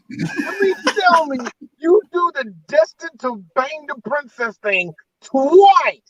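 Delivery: high-pitched incredulous complaint; mock outrage. Fan complaining to director about lazy joke, mock outrage. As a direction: scold him in hammed-up disbelief. Mock rant at Mel Brooks.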